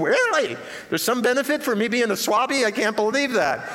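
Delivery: playfully amazed at the discount; mock surprise. Retelling surprised reaction to discount.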